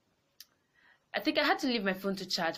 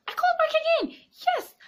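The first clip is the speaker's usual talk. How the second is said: high, loud burst of defiance; defiant exclaim; 10 dB louder than usual. Real, not acted. Telling a friend she called back again, defiant shout. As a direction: fling it out, proud she didn't give up.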